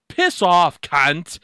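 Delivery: loud, punchy Australian swearing; Australian accent. Shown as a demonstration. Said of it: "That's an Australian one".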